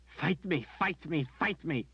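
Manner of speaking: high, tearful demand to fight; pleading high cry of a distraught woman; desperate plea. A narrator's reading. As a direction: beg him to fight you through tears. Berdine begging Richard to fight.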